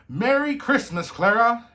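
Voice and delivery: loud, high-pitched hearty cry; jubilant greeting. A narrator's reading. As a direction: burst in calling out a festive greeting. Godfather bursts in at door.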